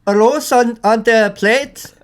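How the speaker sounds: put-on hammy Spanish accent, drawn out; mock Spanish accent. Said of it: "Is that your Spanish accent".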